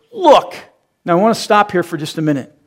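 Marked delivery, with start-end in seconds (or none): 0.1-0.5 sharp high bark of Look; snapping Look. Retold or imitated. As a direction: throw Look at him with contempt. Son snapping at father.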